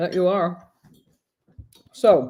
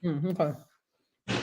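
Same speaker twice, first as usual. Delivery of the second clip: messy loud eating; 7 dB quieter, much slower than usual. Real, not acted. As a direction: mouth full, slow. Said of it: "not talking with my mouth full".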